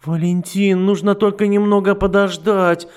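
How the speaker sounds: high, loud desperate begging; a frantic plea; pleading. Acted. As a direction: beg him for time. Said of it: "взмолилась Матильда".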